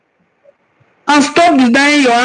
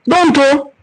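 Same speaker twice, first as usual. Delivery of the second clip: normal talking voice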